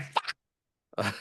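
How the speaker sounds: put-on a kid swearing under his breath in shock; whispered curse. Kid sees the leper covered in sores, hushed curse. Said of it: "And that kid's like".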